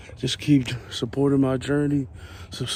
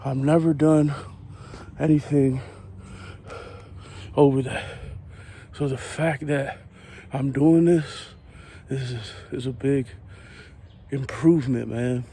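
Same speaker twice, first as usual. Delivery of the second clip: usual speaking voice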